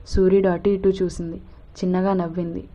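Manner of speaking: plain reading voice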